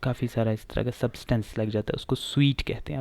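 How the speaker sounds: normal talking voice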